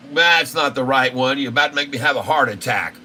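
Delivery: normal talking voice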